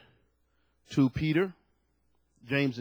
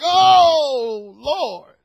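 a loud, swelling cry of delight; rapturous oh Lord; 10 semitones higher, 11 dB louder than usual. Retold or imitated. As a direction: sigh it out in awe of God. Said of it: "it's like".

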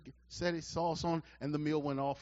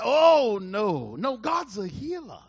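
mock pious refusal; 4 semitones higher, 7 dB louder, slower than usual. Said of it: "I'm deep and I'm spiritual".

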